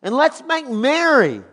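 high, loud, jubilant call to kill the calf; joyful father's command. Acted. Father calling servants to celebrate, loud and joyful. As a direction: shout the order for the feast.

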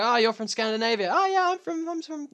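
imitating Scandinavians meeting. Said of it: "they meet each other and they go".

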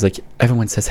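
normal talking voice

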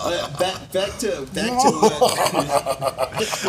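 words break into laughter; laughing. Real, not acted. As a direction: laugh through it, slow. Laughing while trying to continue.

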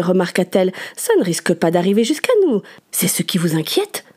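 ordinary delivery

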